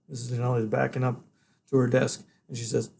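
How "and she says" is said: ordinary delivery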